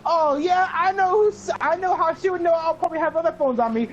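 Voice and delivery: high, loud mock realisation; sudden realisation. Realising how the teacher knew, high and loud. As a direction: piece it together out loud, high and quick. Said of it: "So I'm like".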